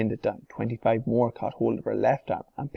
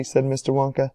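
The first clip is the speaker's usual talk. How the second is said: usual speaking voice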